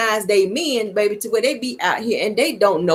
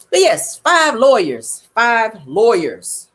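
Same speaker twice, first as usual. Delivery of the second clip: slow, deliberate "five lawyers"; slow emphasis; much slower than usual. Real, not acted. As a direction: spell it out word by word.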